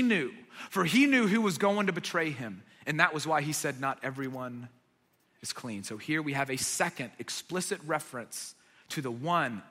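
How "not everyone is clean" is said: much softer and lower; darkly quiet warning; solemn hush. Retold or imitated. Jesus hinting at his betrayer, hushed.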